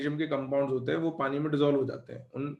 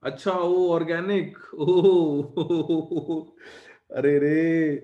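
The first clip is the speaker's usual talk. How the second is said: exasperated surprise; 2 semitones higher, 5 dB louder, much slower than usual. Real, not acted. Teacher reacts to wrong link.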